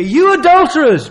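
high, loud denunciation; condemning shout. Acted. Peter condemning his hearers, high loud shout. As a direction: thunder the accusation.

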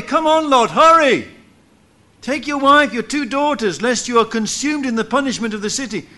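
high, loud urging call; urgent angels. Acted. Angels urging Lot to flee the city, loud urgent call. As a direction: hurry him along at full volume.

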